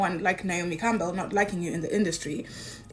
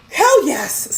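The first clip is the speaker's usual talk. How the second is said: loud, high burst of joy; overjoyed yell of yes; excited yell; 7 semitones higher, 8 dB louder than usual. Retold or imitated. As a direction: shout it like you can't believe your luck. Thrilled at holiday invitation.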